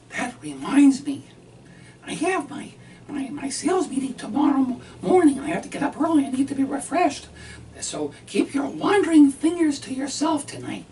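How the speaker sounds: high, loud fussy wife voice; mimicking his wife. Husband voices his wife warning him before her meeting, high and loud. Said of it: "And she says".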